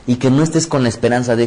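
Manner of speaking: ordinary talk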